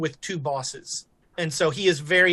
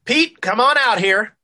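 raised, higher summoning call; 4 semitones higher, 6 dB louder than usual. Acted. Sheriff's boss summons his deputy, calling out. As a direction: call someone out from another room.

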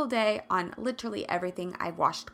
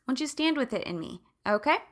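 usual speaking voice

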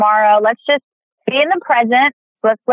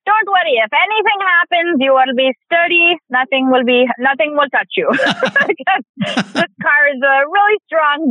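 put-on playful Indian accent, mom soothing her daughter; 3 semitones higher than usual. Guest imitating her mom about the sturdy Volvo, light Indian accent. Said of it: "a very nice Indian accent there".

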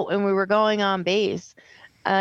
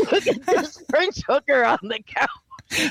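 mock whisper; 3 semitones higher than usual. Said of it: "Six will be like".

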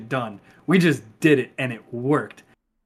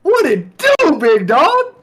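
hyped greeting; 9 semitones higher, 5 dB louder, slower than usual. Real, not acted.

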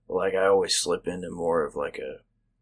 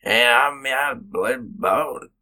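higher, slurred country gibberish; exaggerated country mumble; 5 semitones higher, 5 dB louder, much slower than usual. Shown as a demonstration. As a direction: mash the words into country jabber. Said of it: "but I will literally say".

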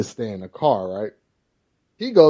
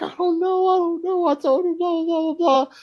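mocking mom. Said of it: "she was like".

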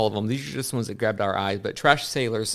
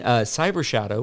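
ordinary talk

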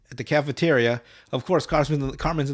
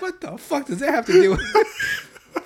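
incredulous; 11 semitones higher, 4 dB louder, slower than usual. Retold or imitated. Incredulous reaction.

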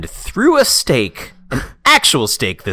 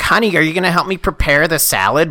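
sweet fake housewife voice; 8 semitones higher, 6 dB louder than usual. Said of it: "she was like".

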